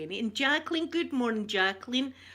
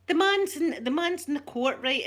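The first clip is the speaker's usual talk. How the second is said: excited; 6 semitones higher, 7 dB louder than usual. Real, not acted. Excited retelling of documentary.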